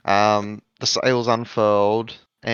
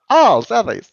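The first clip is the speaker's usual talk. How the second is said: mock realization; 10 semitones higher, 8 dB louder, slower than usual. Captain realises correction.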